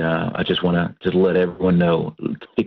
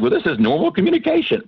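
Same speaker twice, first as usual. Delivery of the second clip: higher and quicker, brushing it off; defensive matter-of-fact; 9 semitones higher, faster than usual. Retold or imitated. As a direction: wave it away as totally normal. Loud Texas family defense.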